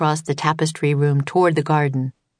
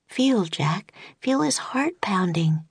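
airy hushed whisper, softer; 4 semitones higher, 5 dB quieter, slower than usual. A narrator's reading. Annie to Jack beside the unicorn, whispering. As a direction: whisper it close, in awe. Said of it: "she whispered".

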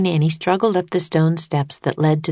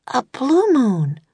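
slow, high and wondering; awed girl; 9 semitones higher, slower than usual. A narrator's reading. Annie realises it's a blue moon, slow awed voice. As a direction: savor the words blue moon. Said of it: "said Annie".

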